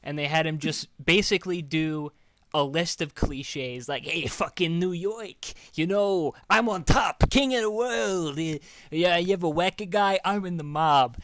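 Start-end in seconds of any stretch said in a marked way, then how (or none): 4.0-11.2 put-on riff in a New York mob accent; Gotti riff. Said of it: "He's just riffing as Gotti".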